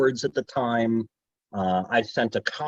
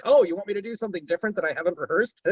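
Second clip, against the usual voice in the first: mock flustered surprise; 7 semitones higher, much faster than usual. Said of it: "So it was like".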